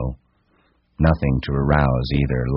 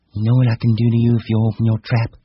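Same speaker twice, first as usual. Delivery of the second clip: high woman's voice, threatening; high, menacing female character; scornful wife; 9 semitones higher, 4 dB louder, faster than usual. Acted.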